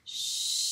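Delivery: "Ch" as a very long hush, then a held ê; drawn-out "Ch" opening into its vowel; sounding out chê. Shown as a demonstration. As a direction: stretch "Ch" slowly while writing it. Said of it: "dire tous les sons qu'on écrit".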